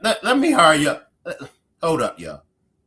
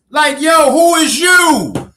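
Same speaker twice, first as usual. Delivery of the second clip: higher, louder, incredulous yell; who is you, yelled in laughing disbelief; mock outraged shout; 6 semitones higher, 3 dB louder than usual.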